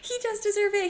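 high gleeful agreement. Real, not acted.

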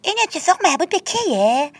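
put-on child's voice. A narrator's reading. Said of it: "بتی پرسید".

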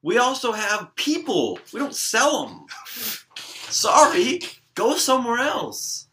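high-pitched, loud brush-off; exasperated mock clerk. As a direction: send the customer packing in a high, fed-up voice. Mock clerk refusing a customer.

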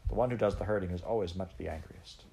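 usual speaking voice

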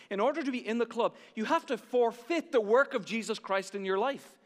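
fast urgent preaching. Acted. Preaching at full force.

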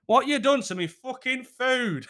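high, drawn-out comic outrage; going mad. Retold or imitated. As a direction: explode in mock fury over dinner. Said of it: "that's my cue to go mad".

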